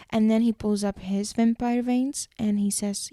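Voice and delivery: usual speaking voice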